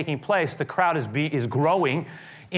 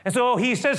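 normal talking voice

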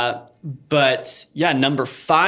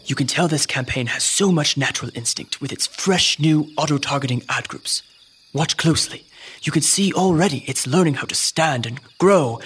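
hushed wildlife narrator; nature documentary. Acted. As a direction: marvel at it like a naturalist.

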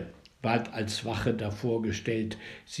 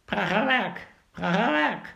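higher, louder quacking cry; duck's exclamation; 6 semitones higher, 3 dB louder than usual. A narrator's reading. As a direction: cry it out like a waddling duck. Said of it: "mit dem Ausruf".

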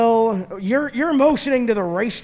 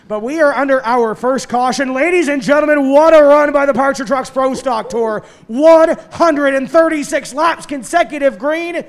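louder, higher announcer call; 4 semitones higher, 6 dB louder than usual. Real, not acted. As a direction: announce it to the whole crowd. Caution announcement to crowd.

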